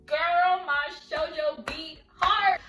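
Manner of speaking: loud, drawn-out gushing; emphatic, overexcited swoon; fangirl outburst. Acted. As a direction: belt it out, stretching the last word. Fangirl reaction to sweet line.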